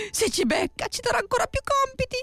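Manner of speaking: plain reading voice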